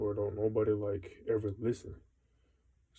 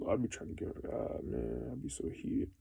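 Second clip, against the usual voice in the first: unvoiced, much softer mutter; muttered frustration; 5 dB quieter than usual. Real, not acted. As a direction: whisper it under your breath, fed up. Confides his frustration.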